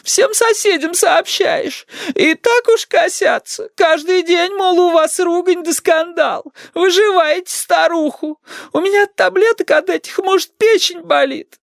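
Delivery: put-on high, tearful old-woman lament; drawn-out, high granny whine; pleading grandma. A narrator's reading. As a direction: wail the complaints slowly, as the wronged granny.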